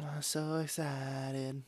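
slow, soft, flat-toned sarcasm; deadpan mock excitement. Cohost mocking his own excitement about the show, deadpan and slow.

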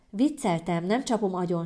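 usual speaking voice

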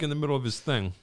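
ordinary delivery

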